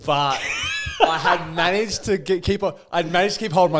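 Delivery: laughing. Real, not acted. Laughing at stolen wallet story.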